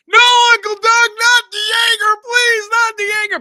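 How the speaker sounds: high, loud pleading yell; hammed-up plea not to drink the Jaeger; mock begging. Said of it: "I'm on my knees just going".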